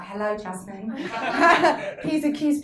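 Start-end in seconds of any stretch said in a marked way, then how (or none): 0.0-0.9 posh polite. Shown as a demonstration. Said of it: "My voice is gonna change now".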